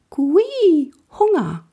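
high piping owl voice begging; begging owl call. Acted. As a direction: squeak it like a hungry owl chick. Owl female begs for food.

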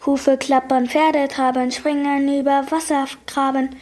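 ordinary delivery

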